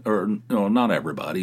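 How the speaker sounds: ordinary delivery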